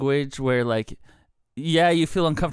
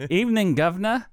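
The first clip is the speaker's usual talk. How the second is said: higher, bright mock Cockney Evening, governor; Cockney greeting; 7 semitones higher than usual. Mary Poppins Cockney greeting, higher. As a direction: tip your hat like a Mary Poppins chimney sweep. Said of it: "like in Mary Poppins".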